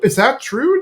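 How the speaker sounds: gasped mock disbelief; Peggy. Peggy asking Tammy in the library, mildly shocked. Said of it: "Peggy's like".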